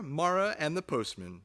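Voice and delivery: usual speaking voice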